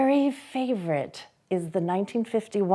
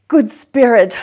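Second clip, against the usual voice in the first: a loud desperate plea; pleading cry; 4 semitones higher, 14 dB louder than usual. Acted. Scrooge fallen before the ghost, crying out. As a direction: cry out to the spirit, begging. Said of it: "as down upon the ground he fell".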